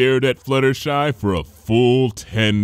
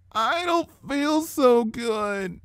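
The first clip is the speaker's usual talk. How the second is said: sick little sister; 10 semitones higher, much slower than usual. Acted.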